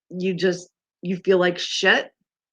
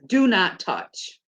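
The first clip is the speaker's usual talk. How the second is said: very slow firm words; much slower than usual. Shown as a demonstration. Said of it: "a sign that says".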